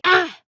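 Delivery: loud high shout of effort; a sudden yell; sharp cry. Acted. Fighter struck in combat, sharp cry.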